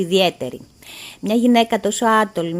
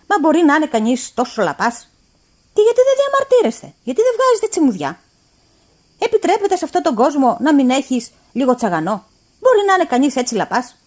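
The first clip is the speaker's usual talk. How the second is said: exasperated shout; 5 semitones higher, 5 dB louder than usual. A narrator's reading. Employer scolding timid governess.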